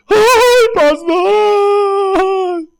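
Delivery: whiny high mock wail of a churchgoer; lloriqueo burlón. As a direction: mimic a sniveling member begging the pastor. Feligrés quejándose, voz lloriqueante. (lloriqueo agudo y burlón de un feligrés)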